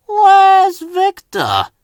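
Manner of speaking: slow puff. A narrator's reading. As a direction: stretch it out like a blocked funnel. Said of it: "he puffed slowly".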